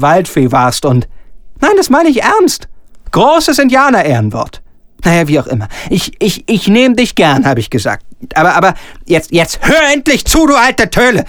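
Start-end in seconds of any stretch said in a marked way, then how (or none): 1.6-4.6 high squeaky assurance; mock earnest protest. Director assuring Gitti he means it, high protest. 9.3-11.2 high impatient outburst; exasperated. Acted. Director losing patience with weeping Gitti, exasperated.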